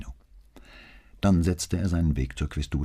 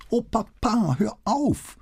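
high, gleeful squeal; delighted squeal; slower than usual. Acted. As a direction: squeal it happily. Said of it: "quietschte sie vergnügt".

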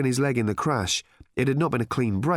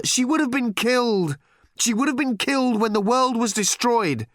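high, urgent raised voice; heated protest; 13 semitones higher, 4 dB louder than usual. A narrator's reading. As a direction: argue back in distress, pitch raised. Father protesting in a heated quarrel.

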